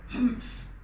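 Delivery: breathy unvoiced humph; huff. King huffing at the passed test. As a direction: blow it out like an annoyed king. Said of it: "the king huffed".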